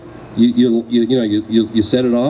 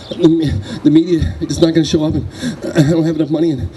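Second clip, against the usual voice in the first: anxious rambling; 4 semitones higher, faster than usual. Retold or imitated. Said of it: "I started rambling".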